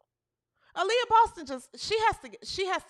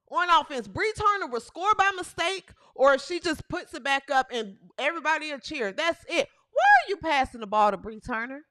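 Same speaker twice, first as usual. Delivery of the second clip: ordinary delivery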